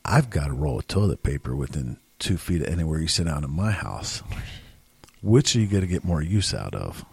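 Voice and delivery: gruff inner voice. Retold or imitated. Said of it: "I'm thinking to myself".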